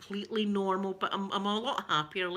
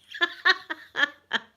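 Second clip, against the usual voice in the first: laugh; 9 semitones higher, 6 dB louder than usual. Real, not acted. Laughs at French tattoo joke.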